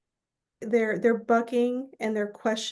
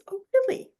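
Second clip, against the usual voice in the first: much higher, skeptical surprise; doubtful oh really; 3 dB louder than usual. Retold or imitated. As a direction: raise an eyebrow at the idea. Grandma doubting the plan.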